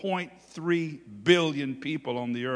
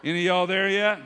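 ordinary talk, nothing marked

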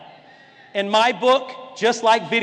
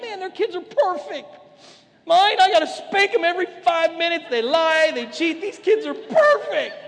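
put-on higher, half-laughing complaint about his own kids; envious parent; 6 semitones higher than usual.

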